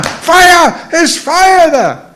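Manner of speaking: high acted cry of fire; screamed alarm. Somebody warns the temple room, screaming alarm. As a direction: shout the warning, stretching the end. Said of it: "runs in the door and screams".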